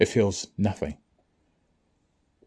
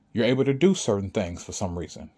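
ordinary delivery

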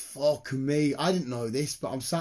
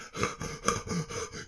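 gasp for air; 2 semitones higher, 5 dB quieter than usual. Retold or imitated. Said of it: "He's like".

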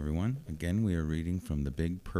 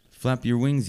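higher, mildly louder encouraging yell of "wings"; 6 semitones higher, 4 dB louder than usual. Acted. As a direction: call up to the flying chick. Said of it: "yelled Missus Bird".

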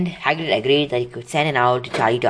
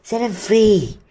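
urging; 9 semitones higher, 3 dB louder, slower than usual. Acted. Harry urging Hagrid.

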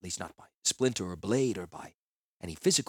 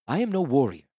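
usual speaking voice

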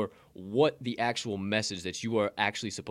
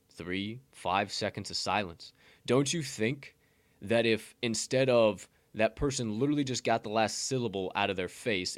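ordinary delivery

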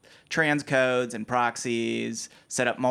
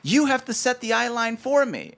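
pitched well higher and louder, indignant demand; snippy actor; 11 semitones higher, 4 dB louder than usual. Retold or imitated. The gaffer mimics the diva actor demanding he set the eye line, indignant and high. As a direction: play the entitled actor throwing the job back.

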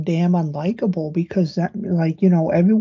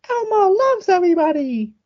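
high-pitched cuddly puppet voice; high cuddly Elmo voice; 13 semitones higher than usual. Retold or imitated. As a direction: squeak it out sweet and cuddly. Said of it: "more high-pitched, cuddly".

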